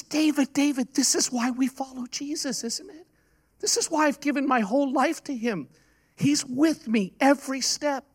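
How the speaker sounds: high-pitched, pleading retold conviction; earnest appeal.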